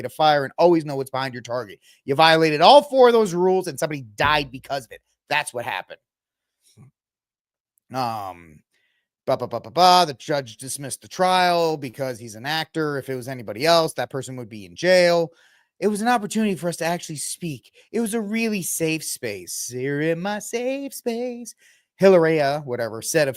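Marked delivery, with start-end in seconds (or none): 2.0-5.0 louder, pointed accusation; scolding the actor who fired the gun; angry scolding. Real, not acted. Scolding Alec. 19.7-21.5 high, slow mocking voice; mocking the wife's safe-space line; mock prissy quote.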